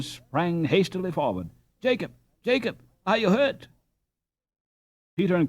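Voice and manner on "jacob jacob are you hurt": worried call. Acted. Ben rushing to fallen friend.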